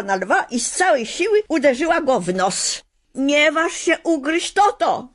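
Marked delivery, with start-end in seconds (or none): 3.1-5.1 much higher, louder scolding shout; shouting girl. A narrator's reading. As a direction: yell at the lion to stop. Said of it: "krzyknęła".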